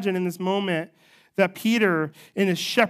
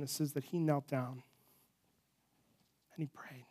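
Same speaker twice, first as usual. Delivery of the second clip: much softer, lower prayerful telling; hushed sermon; 5 semitones lower, 11 dB quieter than usual. Acted. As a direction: drop to a near-hush, reverent. Hushed reflective stretch of sermon.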